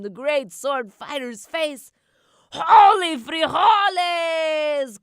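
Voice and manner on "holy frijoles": high, very loud, stretched shout. A narrator's reading. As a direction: cry it out with sword raised. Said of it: "cried Skipito".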